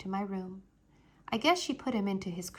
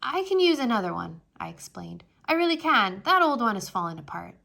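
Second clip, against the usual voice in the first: hurried excuse; 6 dB louder, faster than usual. Acted. Peter covering embarrassment.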